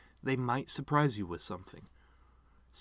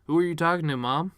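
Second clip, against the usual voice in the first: loud, higher call to Mom; 6 semitones higher, 7 dB louder, faster than usual. Acted. Son calling to his dead mother, loud and high. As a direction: call out into the empty room.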